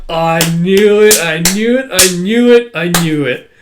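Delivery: loud, drawn-out first cry, then repeated; excited triumph. Retold or imitated. Child recognizing the dog, excited triumph. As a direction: chant it like a thrilled kid.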